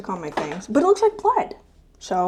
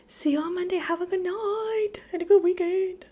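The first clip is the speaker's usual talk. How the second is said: high sign-off; 11 semitones higher than usual. Real, not acted. Sign-off to the viewers.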